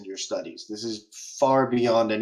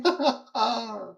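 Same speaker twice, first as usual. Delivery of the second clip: giggling laugh; 9 semitones higher than usual. Acted. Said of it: "He's just spinning on the chair".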